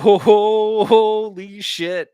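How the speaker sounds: loud, high, slow stammered shock; stunned realization. Retold or imitated. Recalling nearly drinking vodka sober, loud slow stunned exclamation. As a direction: stammer it out as the danger dawns.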